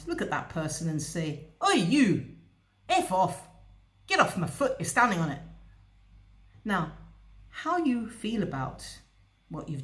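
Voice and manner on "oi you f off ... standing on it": loud, blunt, telling him off; blunt Scots outburst. Retold or imitated. Stranger on your foot, told loudly to get off. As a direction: bark it straight at the foot-stander. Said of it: "the Scots person would turn around".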